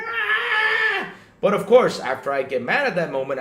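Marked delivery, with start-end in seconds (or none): 0.0-1.1 sudden high, loud "Ah!" of anger; mock angry yell. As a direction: yell like someone just hit your car. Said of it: "I'll be like".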